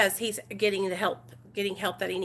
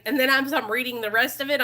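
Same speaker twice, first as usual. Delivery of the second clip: ordinary talk, nothing marked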